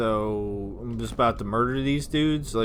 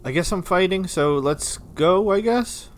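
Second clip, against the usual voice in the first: unsure; 9 semitones higher, 5 dB louder than usual. Real, not acted. Hesitant resignation.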